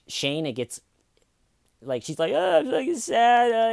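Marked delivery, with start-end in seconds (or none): 2.3-3.7 very high, slow, fake-weepy wail; mock sad. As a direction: lay on exaggerated sorrow in a high voice. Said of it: "she kinda pulls out of that".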